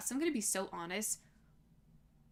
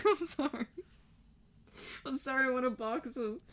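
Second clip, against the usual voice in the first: laughing apology; 3 semitones higher than usual. Real, not acted. Cracks up at own threat.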